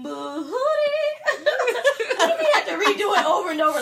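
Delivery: laughing cry-shout; half-crying through laughter; fake crying. Real, not acted. Laughing tale of studio takes.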